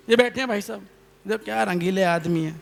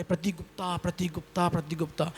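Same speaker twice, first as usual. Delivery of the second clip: much softer echo of the name, dying away; fading echo; 10 dB quieter than usual. Retold or imitated. Echo answering the poet's called name, fading. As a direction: repeat it faintly as the echo coming back. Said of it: "आवाज़ लौट के".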